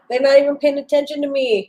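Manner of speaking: high, loud mock grievance with laughter; mock complaint. Co-host voicing Ricky ignored in the chat, mock complaint. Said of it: "and he is like".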